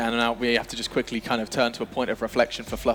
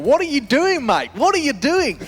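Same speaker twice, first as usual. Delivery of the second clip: much higher, loud hammed-up Australian yell at the screen; Mitch in an awful Australian accent; 13 semitones higher, 6 dB louder, slower than usual. Imitating Mitch yelling at a player on screen, awful Australian accent. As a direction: bellow it like an exasperated Aussie fan, bad Australian accent. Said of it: "That's my awful Australian accent done".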